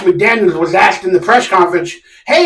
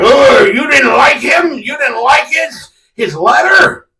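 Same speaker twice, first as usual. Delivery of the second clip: higher, slow whiny mock of a reader; mock-dumb commenter voice; mocking fan voice; 6 semitones higher, slower than usual. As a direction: sneer it like a know-it-all fan. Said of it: "they're like".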